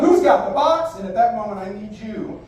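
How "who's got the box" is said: high, loud showman's call-out. Shown as a demonstration. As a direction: call it across the room, raised pitch and volume. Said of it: "I'm gonna call out".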